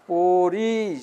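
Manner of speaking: পড়ি stretched, its final ই held; drawn-out ending. Shown as a demonstration. Teacher showing students how to pronounce it, পড়ি drawn out. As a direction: hold the last vowel of পড়ি long. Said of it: "শেষে টান দিবে".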